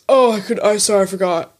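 loud mock-apologetic boyfriend; put-on sheepish apology; mocking excuse. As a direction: imitate a fumbling excuse, starting loud. Voicing Tom's excuse.

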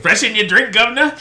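loud, high mock Cockney; English accent. Host teased about his accent hams up the English one. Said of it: "that's your English accent".

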